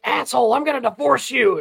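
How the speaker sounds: high, loud mock outburst; imitating a wife's playful fury; wife's mock threat. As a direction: holler it as a joke threat in front of the in-laws. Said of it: "she flat in front of my parents one time was like".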